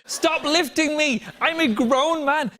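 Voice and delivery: slow, high, loud protest; drawn-out, hammed-up indignant yell; acted exasperated shout. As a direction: yell it word by word at the crowd. Acted plea to the TV audience.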